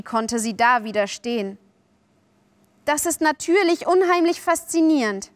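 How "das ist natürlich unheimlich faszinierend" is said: high, enthusiastic admission; thrilled fascination; fascinated. A narrator's reading. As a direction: give in with open delight. Wendy gives in, fascinated.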